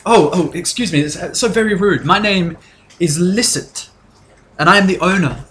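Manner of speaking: high, polite innkeeper voice; barmaid Licit. Acted. Innkeeper introducing herself to guests, high and polite. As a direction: fluster politely, voice lifted. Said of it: "She goes".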